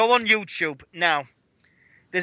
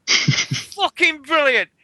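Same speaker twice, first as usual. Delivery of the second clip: cracking up, higher and louder, slowed down; laughing hard; 9 semitones higher, 5 dB louder, much slower than usual. Real, not acted. Laughing with Nick at the Tyrus send-up, cracking up. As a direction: laugh through the words, barely able to talk. Laughing after the retold sketch.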